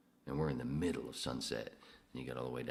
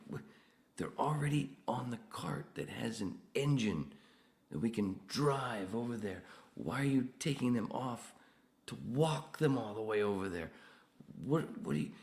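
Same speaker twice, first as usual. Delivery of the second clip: exasperated reasoning; 10 semitones higher, 5 dB louder than usual. Retold or imitated. Explaining the golf cart to a coworker, high exasperated. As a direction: spell out the obvious in disbelief.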